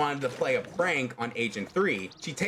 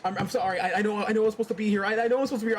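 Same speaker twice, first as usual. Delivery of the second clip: high, rushed, pleading apology; acted frantic begging, tumbling over the words; panicked speed talking; 7 semitones higher, much faster than usual. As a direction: stammer out apologies as if about to cry. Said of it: "speed talking".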